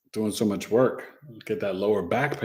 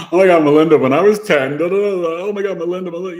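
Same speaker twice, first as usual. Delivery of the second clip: loud, high, breathless gushing; gushing oversharer; 7 semitones higher, 9 dB louder, faster than usual. Retold or imitated. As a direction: spill a life story excitedly. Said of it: "vomit their life story on you".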